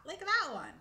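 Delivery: sudden high, loud outburst; calling attention. Acted. Elderly squirrel to his companion about a stranger, sudden loud outburst. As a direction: point it out loudly and high.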